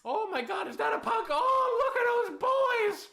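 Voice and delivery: high, loud delighted squeal; excited squeal. Real, not acted. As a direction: squeal with delight at cute animals. Sees cute pug and pigs.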